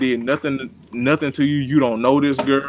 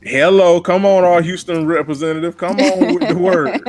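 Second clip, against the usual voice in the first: hype; 4 semitones higher, 4 dB louder than usual. Real, not acted. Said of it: "hyping co-host's phrase".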